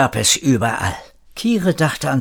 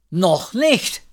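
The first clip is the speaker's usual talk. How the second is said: short higher-pitched call, lightly voiced; second woman calling; 7 semitones higher than usual. A narrator's reading. Second woman chimes in from the pool, lightly voiced call. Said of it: "rief eine zweite".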